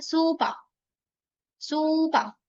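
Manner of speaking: सूपा with both vowels held long; सूपा drawn out like a teacher modelling; long vowel suupaa. Shown as a demonstration. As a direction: stretch सू and पा evenly. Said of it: "सू के पीछे भी long ये लगाना पड़ेगा".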